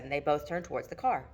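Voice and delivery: ordinary delivery, nothing marked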